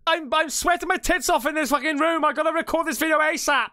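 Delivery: high-pitched exasperated outburst; irritated yelling. Real, not acted. Telling someone off while recording in a hot room, exasperated. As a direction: call out sharply to whoever interrupted.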